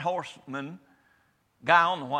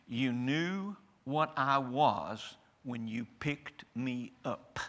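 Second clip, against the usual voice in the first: slow snake voice; 3 semitones lower, much slower than usual. Acted. Snake answers the man in fable.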